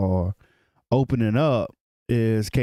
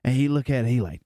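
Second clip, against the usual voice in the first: ordinary talk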